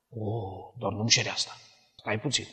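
a quiet, hushed plea not to be asked; lightly voiced reluctant believer; soft pleading. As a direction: beg softly, almost under the breath. Believer begging the Lord.

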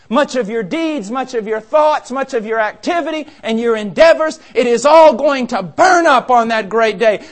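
booming, slow warning from the pulpit, rising to a shout; preaching at full force. Acted. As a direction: thunder it out like a fiery preacher.

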